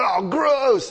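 loud, high mock "Oh, gross!"; disgusted outcry. The crowd reacts to something hanging from a nose, mock disgusted outcry. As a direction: squeal it like the whole room is grossed out.

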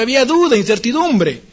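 ordinary talk

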